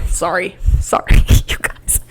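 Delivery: tearful apology. Real, not acted. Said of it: "makes me really sad".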